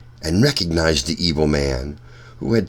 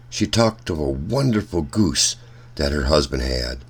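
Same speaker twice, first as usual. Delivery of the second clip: plain reading voice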